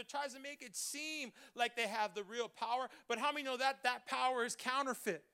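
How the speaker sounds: usual speaking voice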